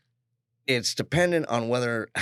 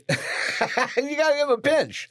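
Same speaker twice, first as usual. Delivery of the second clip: laughing; slower than usual. Real, not acted. Laughing about the mouse pad.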